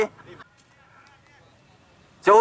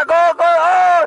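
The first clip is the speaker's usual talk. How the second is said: excited call; 7 semitones higher, 4 dB louder, slower than usual. Real, not acted. Near goal call.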